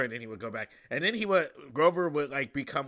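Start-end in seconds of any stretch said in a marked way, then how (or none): none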